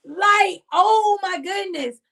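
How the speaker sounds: high, loud oh my goodness; excited exclaim. Real, not acted. Preacher to viewers, shouting her joy about the blessing. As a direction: burst out with delight. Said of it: "I'm excited".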